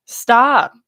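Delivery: a loud, falling stop, mock stern; sharp stop. Telling viewers to quit chasing exes, loud mock stern stop. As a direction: bark stop like a command, half joking.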